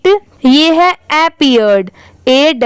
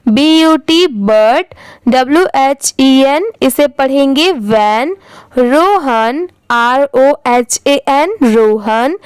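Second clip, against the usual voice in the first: ordinary talk, nothing marked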